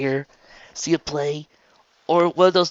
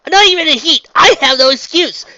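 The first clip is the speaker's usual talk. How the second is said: high, loud distraught confession; self-blame pouring out at a near shout; choking back tears; 15 dB louder than usual. A narrator's reading. Raindrops blames herself to Trixie, fighting back tears. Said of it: "she said, choking back on tears".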